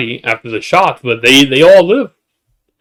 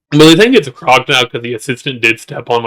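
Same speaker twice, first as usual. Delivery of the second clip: ordinary delivery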